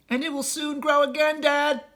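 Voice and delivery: put-on high little fox child voice; a young cub pleading with his father; small fox child. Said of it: "said one of the small foxes".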